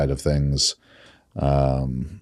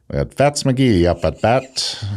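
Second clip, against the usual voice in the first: put-on old-timey radio announcer; slow, drawn-out ballpark call; 1950s narrator; 9 semitones higher, 3 dB louder, slower than usual. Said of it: "those old-timey voices".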